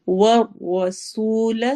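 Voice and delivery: والرسول with a thick ر, hissing س and a long سو; thick ر and a whistling سین, slow; heavy ra, whistling sin. Shown as a demonstration. Teacher demonstrating والرسول, slow. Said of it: "را موٹی پڑھی جائے گی".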